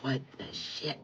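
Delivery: very soft, low muttered disbelief; nearly whispered astonishment; hushed shock. Real, not acted. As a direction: say it under the breath, stunned. Stunned as wind chimes go off.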